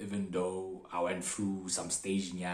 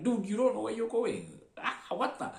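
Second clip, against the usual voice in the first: high-pitched mock exasperation; frustrated; 13 semitones higher than usual. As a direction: imitate fed-up passengers in a raised pitch. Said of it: "they were all frustrated".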